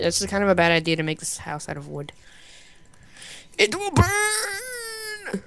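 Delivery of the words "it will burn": long high drawn-out wail; dramatic wail. Pretend. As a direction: wail it melodramatically. Wooden house danger.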